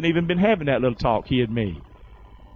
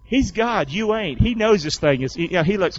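normal talking voice

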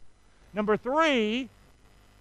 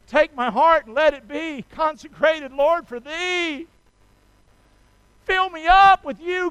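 rising, loud, pleading prayer; preacher praying at full force; impassioned prayer; 5 semitones higher, 7 dB louder than usual. Acted. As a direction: pour your heart out to God. Voiced consecrating prayer.

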